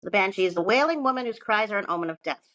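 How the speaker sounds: ordinary talk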